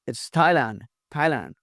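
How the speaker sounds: "Thailand" as TAI-land, aspirated t; correct pronunciation. Shown as a demonstration. Teacher correcting learners, "Thailand" starting with aspirated t. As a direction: say "Thailand" with a plain t and light ending. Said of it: "इनको सही तलफ़्फ़ुज़ आपको आना चाहिए".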